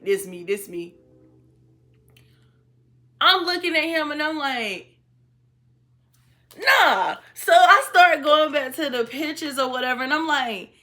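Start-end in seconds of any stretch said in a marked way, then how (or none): 0.0-0.9 man's low voice. Retold or imitated. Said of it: "He was like". 6.6-7.2 high, loud refusal to believe it; retold disbelief. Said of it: "When I tell you I lost it".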